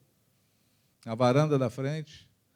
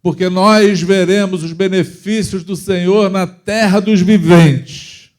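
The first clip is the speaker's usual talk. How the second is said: slow and building to a full, louder proclamation; commanding the soul; 2 semitones higher, 10 dB louder, slower than usual. Retold or imitated. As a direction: order your own soul with faith, rising to a cry. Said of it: "ordena a tua alma". (ordem à alma em voz lenta, crescendo até um brado de fé)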